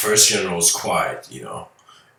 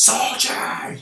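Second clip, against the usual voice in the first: strained shout for a soldier; mock shout. Second general summons his soldier to show bravery, mock shout. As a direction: call out like a boasting general. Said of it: "And he shouts".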